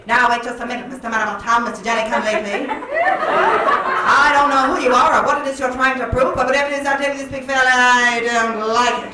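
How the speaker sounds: mock-indignant screen dame scolding; 1940s angry movie woman. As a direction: put a fella in his place like a forties heroine. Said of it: "she'd say".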